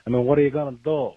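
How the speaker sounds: put-on loud, fast terrible Italian accent. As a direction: belt it like a bad Italian guy. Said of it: "terrible Italian accent".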